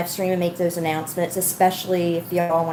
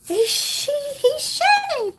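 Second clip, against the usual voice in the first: very high-pitched pet-talk squeal. Real, not acted. Owner noticing the pet is shedding, cooing. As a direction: coo over the pet in a tiny high voice.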